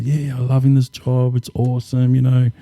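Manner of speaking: high-pitched imitation of a happy worker; delighted. Worker tells recruiter he loves the job, delighted. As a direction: voice lifted high in retold delight.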